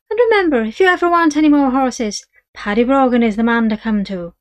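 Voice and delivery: a wheedling Irish trader; Irish horse dealer. Acted. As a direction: sell the horse with easy Irish charm. Dealer's parting pitch.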